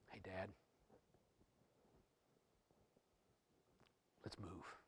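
soft voice with long pauses; quietly confide, as son to father; hushed intimate appeal. Acted. Acts Jesus speaking to the Father.

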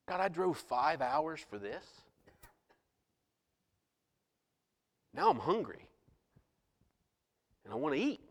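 low, soft mock complaint to God; subdued, frustrated griping; discouraged grumble. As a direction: grumble it quietly, deflated, with long pauses. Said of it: "I began to get discouraged".

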